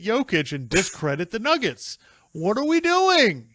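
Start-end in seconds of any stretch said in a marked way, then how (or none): 2.3-3.5 exasperated. Real, not acted. Exasperated rant about critics.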